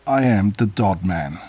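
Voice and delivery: a touch of obvious, as if everyone knows; matter-of-fact. Acted. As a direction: say your name like it needs no explaining. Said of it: "as if it was the most obvious thing imaginable".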